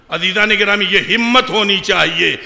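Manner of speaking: ordinary talk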